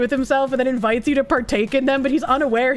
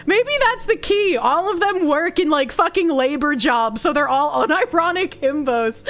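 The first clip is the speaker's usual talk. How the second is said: normal talking voice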